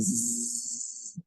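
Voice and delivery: breathy unvoiced snoring sound; whispered snore. Miming sleepy Sleepy Gulch for the children with a soft snore. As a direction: fake a sleepy snore under the music. Storyteller mimics sleepy town.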